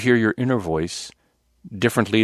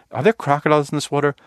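higher, quick, clueless question; idiot inner voice; 5 semitones higher, faster than usual. Retold or imitated. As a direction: imitate your own naive self, pitched up and hurried. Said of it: "the inner voice that I have is kind of this idiot voice".

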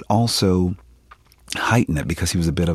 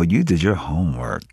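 ordinary talk

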